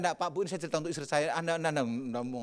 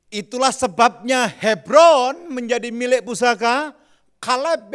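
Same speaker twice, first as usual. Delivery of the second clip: loud, slow emphatic reading; preaching scripture reading; 5 semitones higher, 8 dB louder, slower than usual. Acted. Preacher reads the verse to the congregation, emphatic proclaiming.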